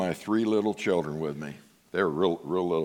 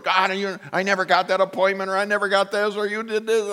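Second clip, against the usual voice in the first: higher, whiny mock grievance; mock complaint; 10 semitones higher than usual. Pastor mimicking believers complaining to God, whiny and higher. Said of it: "Sometimes we're like".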